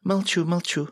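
high-pitched playful compliance; tipsy, giggly. A narrator's reading. Joker playing drunk woman to friend, tipsy giggly voice. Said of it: "Балагур пьяно хихикнул".

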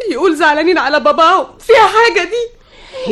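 rising into a high, slow sob; tearful defiance. Acted. Crying daughter defending grief for father, tearful. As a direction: answer back through tears.

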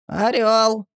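high, thin creaking creature voice; creaky little voice. A narrator's reading. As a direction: squeak it out like a goblin. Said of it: "раздался скрипучий голосок".

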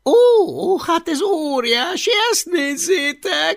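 very high, loud gleeful jeer; mocking delight. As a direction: crow over the find. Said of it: "jutott a nevetéstől". (magas, harsány kárörvendés)

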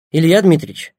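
a doctor's voice over the earpiece; voice in headphones. A narrator's reading. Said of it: "негромкий голос Семёна".

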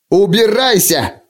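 high, drawn-out angry cry; shouted order. A narrator's reading. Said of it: "крикнул принц".

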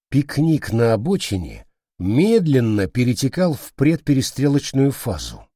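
plain reading voice

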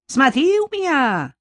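грозно гаркнуть, выше; пригрозить окриком; гаркнула. Acted. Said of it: "гаркнула продавщица". (продавщица гаркает на мужа)

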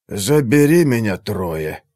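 exhaled in relief, words on breath. A narrator's reading. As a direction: let it out on a sigh. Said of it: "выдохнул Игнаций".

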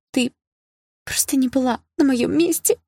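put-on high, tearful girl's voice; voiced as a sobbing schoolgirl. A narrator's reading. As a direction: plead through a sob, pitch lifted. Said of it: "всхлипнула Лина".

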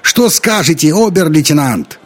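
loud, high challenge; high-pitched heckling question; shouting from afar. A narrator's reading. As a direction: call a challenge from the back rows. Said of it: "крикнул кто-то в задних рядах".

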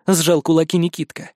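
ordinary delivery, nothing marked

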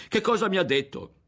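high, loud and heated throughout; an outraged boy defending his mother. Acted. Berto rages to his friend about the girl's lie. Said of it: "Aveva alzato la voce anche adesso ed era acceso".

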